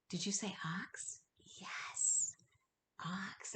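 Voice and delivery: a gasp, then a hushed whisper; gasping excited whisper; whispered surprise. Shown as a demonstration. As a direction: whisper as if it's a big secret. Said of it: "gasp then whispered answer".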